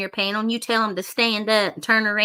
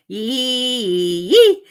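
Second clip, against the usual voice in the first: louder, rising yee yee; excited whoop; 2 semitones higher, 4 dB louder, much slower than usual. Real, not acted. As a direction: whoop it out in triumph. Triumphant whoop.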